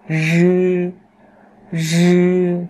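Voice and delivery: "rư" with curled tongue and buzz, held high; retroflex R. Shown as a demonstration. Teacher models the last initial, "rư" as voiced retroflex. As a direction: buzz "rư" on a level high pitch. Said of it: "vị trí vẫn như vậy nhưng chúng ta đọc lại".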